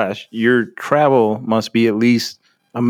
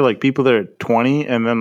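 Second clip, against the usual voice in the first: ordinary talk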